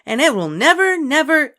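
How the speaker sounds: put-on high, loud pleased child; pleased with herself. A narrator's reading. Ramona answering the librarian, high and pleased. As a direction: say it proudly. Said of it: "pleased with herself".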